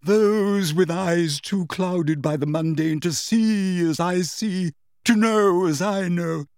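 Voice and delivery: grandly theatrical self-pity; dramatic, wavering. A narrator's reading. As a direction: intone mystically as a persecuted prophetess. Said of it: "dramatic, wavering voice".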